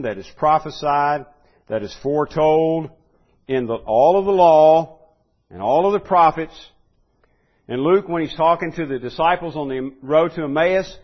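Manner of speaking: preacher in full flow; rising sermon delivery. Acted. Preacher building a list.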